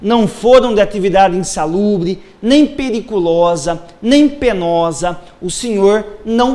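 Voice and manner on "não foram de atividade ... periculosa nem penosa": ordinary delivery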